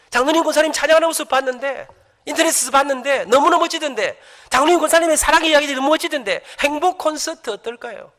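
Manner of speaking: publisher's call. Retold or imitated. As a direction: pitch the idea brightly, slowing at the end. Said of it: "출판사 전화가 왔습니다". (출판사 직원 흉내, 들뜬 목소리로)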